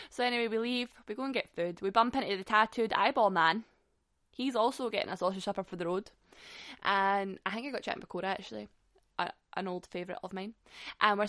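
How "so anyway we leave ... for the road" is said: ordinary delivery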